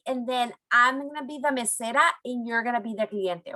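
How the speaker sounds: usual speaking voice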